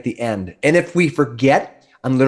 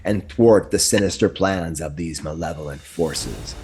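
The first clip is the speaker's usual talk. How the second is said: grand fantasy narration over music; epic narration; 5 semitones lower than usual. Acted. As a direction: set the scene like a dark fantasy trailer. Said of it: "Did I oversell it?".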